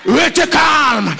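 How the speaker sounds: loud, high and slow: RESTEZ CALME; booming slow preacher's order; shouted command. Acted. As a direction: call "Restez calme" out to the whole church.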